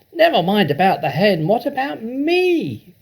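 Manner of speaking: a jealous grandma calling for attention; Grandma shouting. A narrator's reading. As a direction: demand attention from the rooftop. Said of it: "Grandma shouted from the rooftop".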